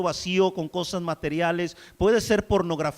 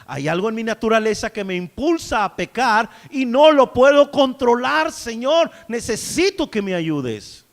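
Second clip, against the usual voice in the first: loud, slow, pleading prayer to the Lord; fervent preacher's prayer, loud and slow; 3 semitones higher, 6 dB louder than usual. Acted. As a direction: cry out to God for cleansing. Pleading prayer voiced to God.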